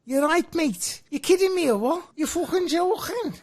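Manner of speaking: high-pitched put-on Scouse; Scouse accent. Shown as a demonstration. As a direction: banter in a hammed-up Scouse accent. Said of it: "this is a Scouser".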